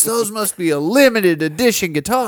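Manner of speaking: put-on high and loud, a know-it-all kid's voice; mock clueless kid. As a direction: ham up the clueless fan. Mocking the kid again.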